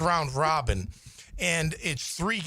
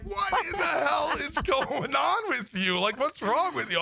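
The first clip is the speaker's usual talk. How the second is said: high, slow, exasperated scolding; wife berating husband for sparring, mock exasperation; 10 semitones higher than usual. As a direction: scold him as the fed-up wife.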